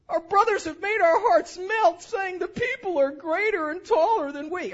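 high, loud whining complaint; whiny voice. Pretend. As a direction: whine it like sulky Israelites. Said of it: "Maybe I'll do a little whiny voice".

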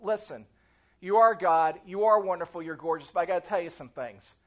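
low, frank address to God; acted plain-spoken prayer; gutsy praying. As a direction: acknowledge them firmly, then get down to business. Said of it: "walking into the presence of God and saying this".

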